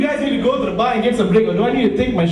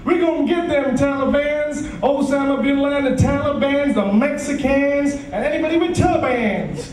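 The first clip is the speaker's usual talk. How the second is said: strut it like a war-talking president; George Bush impression; 6 semitones higher than usual. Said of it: "he has a different walk".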